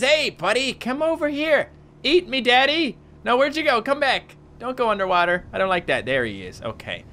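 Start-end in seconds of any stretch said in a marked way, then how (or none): 0.0-5.4 coaxing. Real, not acted. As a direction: lure the shark like a pet. Coaxing the shark to eat him.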